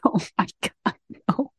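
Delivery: breathy whisper of embarrassment; whispered dismay. Retold or imitated. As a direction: whisper it, mortified. Embarrassed by camera.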